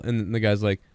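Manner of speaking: usual speaking voice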